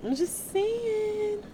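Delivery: high, slow, drawn-out sing-song aside. Real, not acted. Preacher teasing the congregation, in a high, slow sing-song. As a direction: float it up high and stretch it out.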